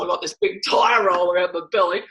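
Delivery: ordinary delivery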